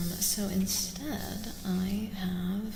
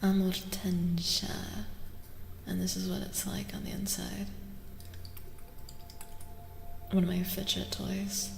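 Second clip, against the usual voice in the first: breathy intimate stream whisper; ASMR whisper; 13 dB louder than usual. Real, not acted. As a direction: whisper gently to the viewers as if right beside them.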